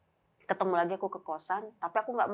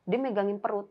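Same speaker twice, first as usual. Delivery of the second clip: usual speaking voice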